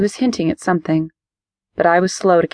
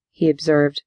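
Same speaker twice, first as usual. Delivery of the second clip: ordinary delivery, nothing marked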